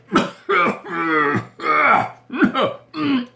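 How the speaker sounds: hammed-up cough, high and raspy; fake cough. Husband denying he's sick, coughing for show.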